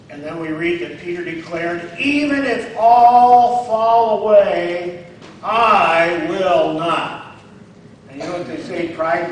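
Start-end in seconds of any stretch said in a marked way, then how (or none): 2.0-7.4 proud boast. Acted. Peter's boast quoted in sermon.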